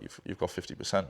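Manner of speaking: low, soft, offhand retelling; nonchalant. Retold or imitated. Partner handing over half the company, nonchalant. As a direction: drop it in as if it were nothing. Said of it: "like, just nonchalant".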